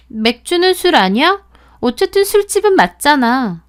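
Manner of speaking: snide retort. Acted. Friend sneering at narrator about the bar, smug needling retort. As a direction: twist the knife, smug.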